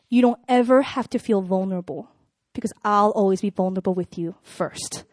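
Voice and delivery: low, soft, measured promise with long pauses; quiet sincere boyfriend. Retold or imitated. Woman voices boyfriend's promise to her, quietly sincere. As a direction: reassure gently, low and unhurried. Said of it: "he's a very quiet guy".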